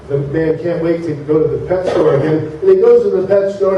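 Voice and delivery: ordinary talk, nothing marked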